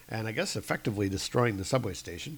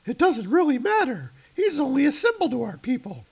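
high put-on voice; 12 semitones higher than usual. Character answers Dargo.

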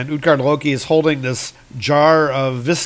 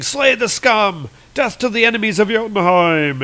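battle cry; 8 semitones higher than usual. Acted. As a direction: cry out as you burst through the door. Giants bursting in to kill prisoner.

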